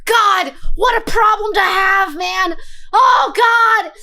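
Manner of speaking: loud, high excited exclaiming. Real, not acted. Streamer reacting to the ASMR fantasy, loud and excited. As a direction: gush over it loudly.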